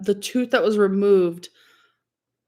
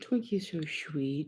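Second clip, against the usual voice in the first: soft and tender; 5 semitones lower, 13 dB quieter, slower than usual. Real, not acted. Soft talk about sleeping dog.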